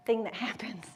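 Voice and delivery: laughing whisper. Real, not acted.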